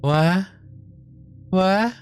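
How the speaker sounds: building confusion. Real, not acted. Rising confusion.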